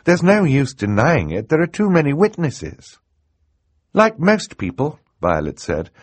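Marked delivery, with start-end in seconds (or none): 0.0-3.0 high-pitched, regretful woman; sad. Acted. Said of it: "Justice Strauss said sadly". 3.9-5.0 put-on high young girl's voice; explaining girl. Girl heroine voiced in narration.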